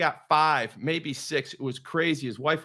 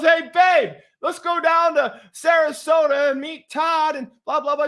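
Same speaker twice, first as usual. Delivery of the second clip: high, loud call to a partner; 8 semitones higher, 4 dB louder than usual. Retold or imitated. Coach calling his wife about visiting Todd, calling out. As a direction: imitate hollering to the wife.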